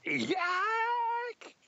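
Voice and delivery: very high, shouted dragon cry; dragon shriek. Pretend. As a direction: screech it out like a dragon. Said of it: "if you do the dragon sound effect".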